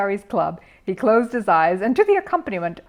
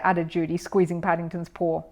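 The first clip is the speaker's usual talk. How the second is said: ordinary delivery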